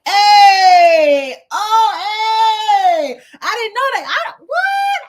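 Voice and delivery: high, loud delighted shouting; excited shout. Retold or imitated. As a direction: burst out in happy discovery. Delighted by new way to wear.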